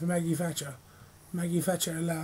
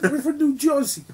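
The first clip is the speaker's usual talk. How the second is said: mock Jersey; 6 semitones higher, 5 dB louder than usual. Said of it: "My father was Italian".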